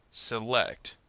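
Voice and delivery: "Select" with weak se, strong LECT; second-syllable stress. Shown as a demonstration. Teacher gives two-syllable verb example, "Select" stressed on LECT. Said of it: "stress on the second syllable".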